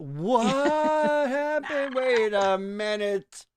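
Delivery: high, slow drawn-out double take; mock surprise. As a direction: stretch it out in fake shock.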